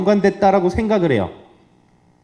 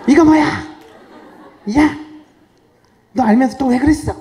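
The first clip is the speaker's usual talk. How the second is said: scolding yell; 9 semitones higher than usual. Retold or imitated. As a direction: yell at the puppy over its accident. Owner scolding dog over pee.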